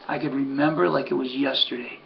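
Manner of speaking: normal talking voice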